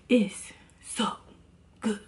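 breathy voiceless whisper of her catchphrase; imitating It's So Good. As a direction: whisper it like her signature line. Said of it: "I think that's It's So Good".

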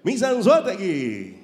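slow, drawn-out host voice; Daniel Sarco. Retold or imitated. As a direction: announce the contestant slowly. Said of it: "Daniel las agarra y dice".

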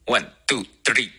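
put-on Indian-accented count, three as "tri"; quick Indian-English one, two, three, hard t for th. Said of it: "如果是印度人来读的话".

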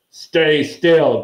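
gruffly acted command; grunted order. A narrator's reading. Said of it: "grunted the gor-, dwarf".

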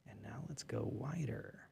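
soft whisper. Real, not acted. Directing the camera zoom, hushed whisper. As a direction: breathe the camera cues quietly.

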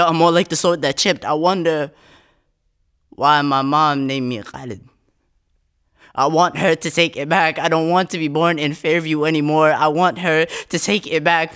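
intense, rising performance poetry delivery; spoken word poem. Acted. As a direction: perform the poem with mounting urgency.

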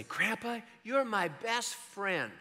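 a hint of a sweet toddler; little grandson. Grandpa quoting his three-year-old grandson, lightly voiced little child.

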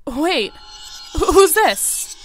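slow startled question. Real, not acted. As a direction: caught off guard, drawn out. Sudden stranger appears in game.

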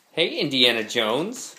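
usual speaking voice

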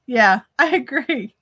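ordinary delivery

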